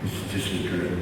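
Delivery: hushed. Real, not acted. Pained realization after dream list.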